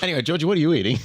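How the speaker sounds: laughing, high and loud. Real, not acted. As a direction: blurt it through laughter. Laughing question to a player.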